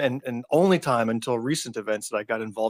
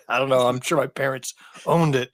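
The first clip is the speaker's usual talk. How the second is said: normal talking voice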